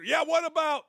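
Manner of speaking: high, whiny heckler's interjection; mimicked objection. Host mimicking objectors to the Clark deal, high mocking protest. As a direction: butt in high-pitched like an objector.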